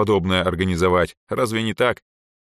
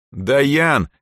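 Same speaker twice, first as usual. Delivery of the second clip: high, loud appeal to a friend; flabbergasted; 8 semitones higher, 5 dB louder, slower than usual. A narrator's reading. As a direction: call out in disbelief, pitched up. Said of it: "обалделый взгляд на подругу".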